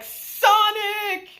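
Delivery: high, gleeful yelp of the name; excited shout. Retold or imitated. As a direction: call out like meeting your idol. Uncle sees the hedgehog.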